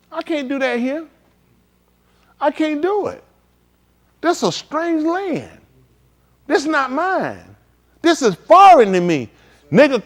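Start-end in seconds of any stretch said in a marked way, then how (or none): 0.1-3.2 high, plaintive voice of a homesick exile; lightly voiced mournful captive; captive's lament. Voicing captives in a strange land. 4.2-5.6 high, slow mournful lament; captive's lament. Acted. Voicing captives in a strange land. 6.5-7.6 high, plaintive voice of a homesick exile; lightly voiced mournful captive; captive's lament. Voicing captives in a strange land.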